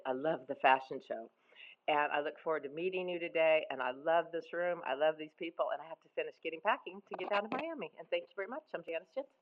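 usual speaking voice

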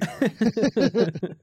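bright burst of laughter; laughing. Real, not acted. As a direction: laugh out loud. Joke about fake barbecue.

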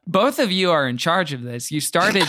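ordinary delivery

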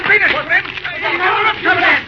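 high, loud shout of warning; urgent warning shout. Acted. Spider yelling at Slim to get clear of the fire. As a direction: yell at them to get clear. Escaping burning roundhouse, warning.

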